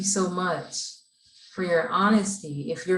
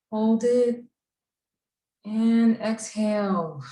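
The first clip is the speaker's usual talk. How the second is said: guided breathing; 2 semitones higher than usual. Acted. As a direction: coach the breaths in and out. Leads final breathing rounds.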